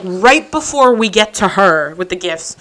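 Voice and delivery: ordinary delivery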